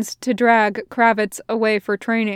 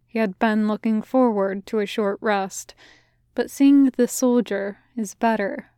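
ordinary delivery

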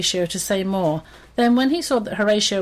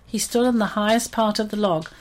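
ordinary delivery, nothing marked

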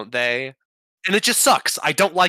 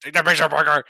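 barking newspaper boss; 4 semitones higher, 6 dB louder than usual. Retold or imitated. Echoes the J Jonah Jameson quote.

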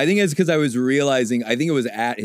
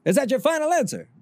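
higher, fast mock quiz host voice; game show host; 14 semitones higher, faster than usual. Teasing friend with a hammed-up game show host voice. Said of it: "Is that good, Regis?".

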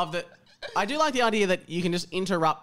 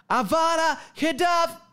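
spell shout; 10 semitones higher than usual. Acted. Reading a spell in the story.

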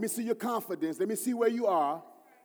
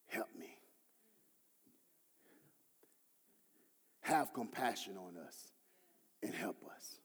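hushed plea; 11 semitones lower, 12 dB quieter than usual. Acted. Praying man's plea, voiced in hushed prayer.

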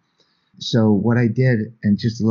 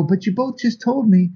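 much higher, incredulous disbelief; 13 semitones higher than usual. Retold or imitated. Telling the family they just shared, much higher incredulous. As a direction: point out the obvious in disbelief. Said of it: "And I go".

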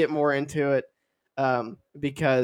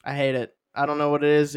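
ordinary delivery

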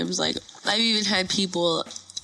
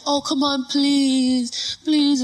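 mock pleading, pitched up; whiny begging, imitating a pushy fan; 5 semitones higher, much slower than usual. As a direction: wheedle "please" like a pest. Fan begs for a kiss.

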